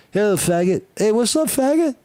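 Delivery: joking greeting that climbs to a high squeal; mock bro greeting. Shown as a demonstration. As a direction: tease a buddy in a rising falsetto. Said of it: "I've used it, uh, uh, recreationally before".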